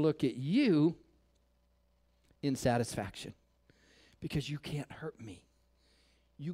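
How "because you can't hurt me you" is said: whispered. Acted. Calm defiance whispered.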